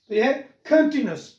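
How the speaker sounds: normal talking voice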